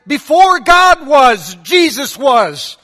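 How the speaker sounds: sweating preacher at full fervor; excited preacher. Retold or imitated. Preacher at his climax before the crowd, mock shout. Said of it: "he's all, all excited".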